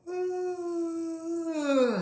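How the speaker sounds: big audible release sigh; deep loud sigh. Real, not acted. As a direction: let out a huge sigh. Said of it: "How rude".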